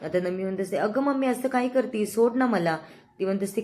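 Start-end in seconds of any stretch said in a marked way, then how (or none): 0.8-2.8 put-on high, whiny plea from a young girl; whiny pleading girl. Daughter begging mother to let go of her ear, high whiny plea. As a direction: beg to be let go, pitched up and whining.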